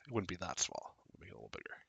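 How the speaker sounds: ordinary delivery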